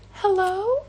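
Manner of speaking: put-on very high, mechanical little-girl voice; creepy doll. Eerie voice at the fence line at midnight, creepy doll. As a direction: imitate a horror-movie doll calling out. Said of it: "it sounds like a creepy doll from a horror movie".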